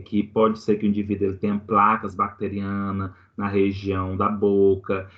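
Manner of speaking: normal talking voice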